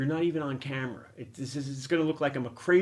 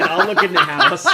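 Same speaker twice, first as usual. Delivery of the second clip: much higher and louder, bursting into laughter; laughing while saying it; 11 dB louder than usual. Real, not acted. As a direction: crack up mid-line. Repeating line, laughing hard.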